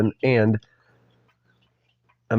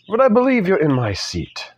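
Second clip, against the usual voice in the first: put-on polite, higher-pitched amateur British accent; 6 semitones higher than usual. British man politely interrupting the lawyer, amateur British accent. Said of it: "he said politely".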